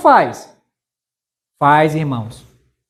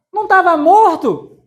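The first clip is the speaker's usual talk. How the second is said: playful amazement, pitched up; mock astonishment; 9 semitones higher than usual. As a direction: exclaim it as a surprised punchline. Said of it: "repeats punchline with emphasis".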